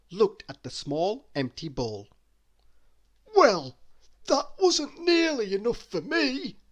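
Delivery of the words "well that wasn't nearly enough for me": high, greedy character voice; high comic greedy voice; Mister Greedy voice. A narrator's reading. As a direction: play the greedy little man, pitched up. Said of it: "he said".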